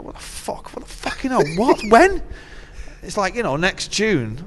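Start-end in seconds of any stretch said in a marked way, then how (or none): 0.0-2.2 a hushed curse, then climbing much higher into a shrill What and When; hammed-up outrage at a question about plans years away; mock disbelief. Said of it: "And I'm like".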